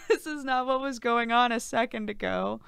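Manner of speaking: high voice still full of crying; real tearful. Real, not acted. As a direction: protest weakly through tears and laughter. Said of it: "I'm sobbing".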